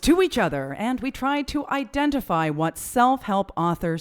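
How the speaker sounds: big booming TV announcer. Shown as a demonstration. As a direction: announce it like a game show host. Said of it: "That's my T V announcer voice".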